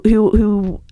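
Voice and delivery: normal talking voice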